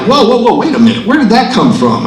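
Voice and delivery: startled. Retold or imitated. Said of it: "And I'm like".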